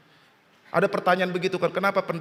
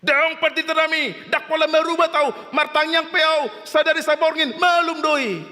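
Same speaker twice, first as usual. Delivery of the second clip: high, raised-pitch declaration in long held phrases; mock insistence, pitched way up and held; insistent believer; 11 semitones higher than usual. Believer refuses medicine before the pastor, high insistent proclaiming.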